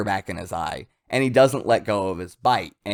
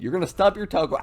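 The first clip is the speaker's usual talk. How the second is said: ordinary talk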